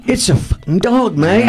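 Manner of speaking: high put-on voice of an incredulous Brit. British rock singer explains the name, imitated high voice.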